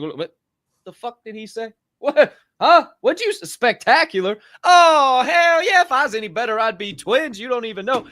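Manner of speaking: high, loud, astonished stranger; surprised reaction voice. Retold or imitated. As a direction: play the startled listener who can't believe it. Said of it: "others react to spectacular".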